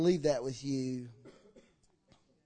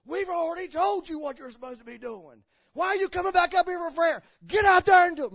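loud, high rebuke rising to a shout; scolding leaders; 11 semitones higher, 9 dB louder than usual. Retold or imitated. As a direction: church leaders berate the man for coming back. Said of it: "the leaders jumped him".

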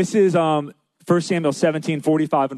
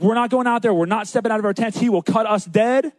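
high-pitched mock alarm; hammed-up reluctance; panicky refusal; 6 semitones higher than usual. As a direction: act out scared soldiers balking. Army refuses to fight.

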